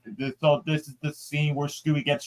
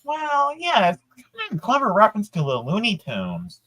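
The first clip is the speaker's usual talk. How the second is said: Shaggy voice; 8 semitones higher than usual. Acted. To the interviewer who brought up the scene, a high Shaggy voice. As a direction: pitch way up and slouch through it like Shaggy.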